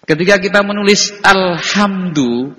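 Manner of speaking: ordinary delivery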